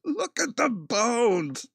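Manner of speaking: very high, slow impression of a crazed film character; [name]. Podcaster repeating his favourite Monty Python line, high drawn-out impression. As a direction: squeal it out, dragging each word. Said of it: "[name] is hilarious".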